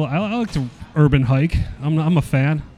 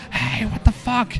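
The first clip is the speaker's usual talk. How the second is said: high, drawn-out yell without full volume; mock yell; 9 semitones higher, 4 dB quieter, slower than usual. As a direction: call out at a driver, holding back volume. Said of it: "I start yelling".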